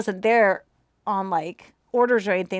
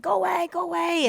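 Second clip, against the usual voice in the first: high, put-on shooing old neighbor; old neighbor shooing kids with cupcakes away, in a put-on voice; grumpy old man; 7 semitones higher than usual.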